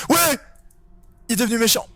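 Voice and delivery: high startled shout; excited yelp. Real, not acted. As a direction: yelp as the monster answers. Employee turns hostile.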